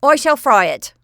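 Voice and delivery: loud, high decree; firm cook. Acted. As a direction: declare it boldly and final. Cook decides firmly.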